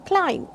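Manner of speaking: "Climb" with the final B left silent. Shown as a demonstration. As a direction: say "Climb" ending on M, no B sound. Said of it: "b is silent".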